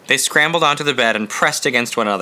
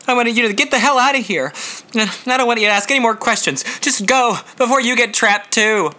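urgent angry shout; 10 semitones higher than usual. Acted. Jack forcing Scotty to leave.

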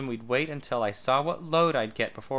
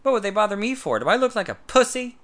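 indignant outrage; 9 semitones higher, 8 dB louder than usual. A narrator's reading. Tad outraged at the idea.

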